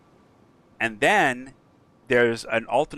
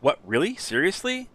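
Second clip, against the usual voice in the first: stunned, can't believe his luck; squeaky astonished seriously; surprised disbelief; 7 semitones higher than usual. Retold or imitated. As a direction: voice leaps way up on seriously. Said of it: "And he's like".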